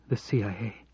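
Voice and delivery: halting, stunned question; stammering disbelief. A narrator's reading. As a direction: stumble over it in shock. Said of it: "she stammered in disbelief".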